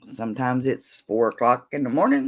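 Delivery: normal talking voice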